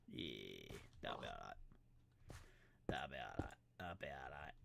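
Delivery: very low, soft rumble; put-on low voice. Player approves the building, low soft rumble. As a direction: drop right down and murmur it.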